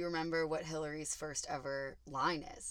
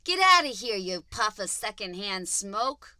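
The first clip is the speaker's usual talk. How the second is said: hammed-up shouted put-down; insulting; 8 dB louder, slower than usual. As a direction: chase them off with a jeer. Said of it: "say them like an insult".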